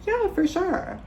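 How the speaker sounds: very high chirpy for sure; squeaky high fake agreement. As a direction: chirp it to end the talk. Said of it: "I was like".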